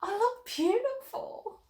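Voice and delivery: put-on very high, slow, wondering girl's voice; a girl seeing herself in her new dress, awed; little girl voice. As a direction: admire yourself in the mirror as a little girl. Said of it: "she exclaimed".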